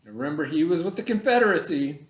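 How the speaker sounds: normal talking voice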